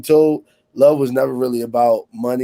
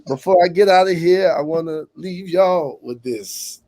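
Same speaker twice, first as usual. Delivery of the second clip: higher Baptist preacher call, stretched out; hollering revival preacher; 7 semitones higher than usual. Pretend. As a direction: preach it to the congregation. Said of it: "Is that Baptist?".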